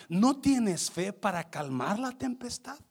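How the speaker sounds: loud, high, shouting preaching; full-force preaching. Acted. Preacher calling the congregation to faith, shouting preaching. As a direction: preach with rising fire.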